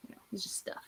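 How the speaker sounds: breathy throwaway whisper. Real, not acted. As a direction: drop to a whisper, shrugging it off. Aside dropping into whisper.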